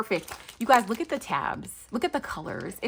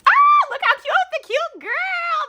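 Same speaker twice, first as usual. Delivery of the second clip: high squeal; 8 dB louder, slower than usual. Real, not acted. Gushing over cute sticker.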